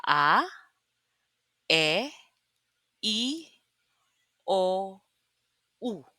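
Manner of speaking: A, E, I, O, U as pure Tagalog vowels, ah eh ee oh oo. Shown as a demonstration. Teacher contrasting Tagalog vowels with English ones. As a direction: say each vowel short and pure. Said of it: "we pronounce the vowels or patinig as".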